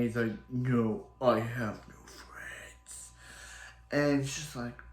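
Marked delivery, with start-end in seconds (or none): 0.5-3.1 very slow, whispery character voice; hushed gruff rejection. Acted. Mick disowning his former friends, slow whispered rejection. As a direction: draw it out in a voiceless rasp.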